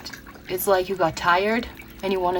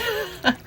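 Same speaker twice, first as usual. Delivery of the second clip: a short high laugh; quick laugh; 13 semitones higher than usual. Real, not acted. Reader thanking viewers, quick high laugh.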